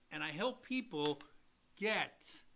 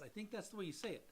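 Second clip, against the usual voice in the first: usual speaking voice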